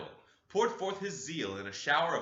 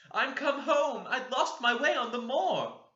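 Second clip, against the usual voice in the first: put-on high, loud, trembling child's voice; a cold little ghost naming herself; shivering ghost child; 13 semitones higher, 7 dB louder than usual. Ghost child answering through the window, high shivering.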